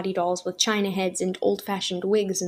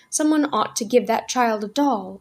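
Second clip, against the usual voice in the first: plain reading voice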